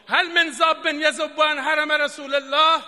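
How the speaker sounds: loud, slow proclamation; retold battlefield cry. Preacher repeating the plea for defenders, loud slow cry. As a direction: call out loudly for defenders, drawing it out. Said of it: "فریاد زد".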